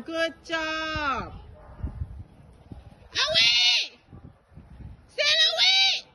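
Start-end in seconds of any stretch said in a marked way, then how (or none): 3.2-6.0 shouting. Real, not acted. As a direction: yell the herding calls. Shouting commands to dogs.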